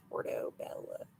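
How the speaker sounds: Portobello breathed out in a whisper; whispered echo. Pretend. Host whispering the mushroom name back to the chat.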